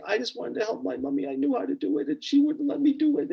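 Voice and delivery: put-on high, plaintive little-girl voice; imitated crying child. Little girl who wanted to help mommy, imitated crying. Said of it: "she's crying and crying and crying".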